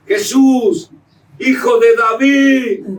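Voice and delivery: "Jesús, hijo de David" cried out high, loud and slow; loud pleading cry. Shown as a demonstration. Preacher demonstrating Bartimeo's cry, high, loud, slow. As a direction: call "Jesús, hijo de David" like a desperate blind beggar. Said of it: "Pero no decía así".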